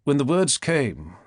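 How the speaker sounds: ordinary delivery, nothing marked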